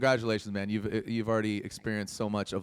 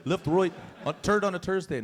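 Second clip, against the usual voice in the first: mock Scottish accent that slides into Irish, pitched up; failed Scottish accent; 7 semitones higher, 4 dB louder than usual. Host teases the Scottish teen, in a hammed-up Irish-sounding brogue. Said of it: "No, that's Irish".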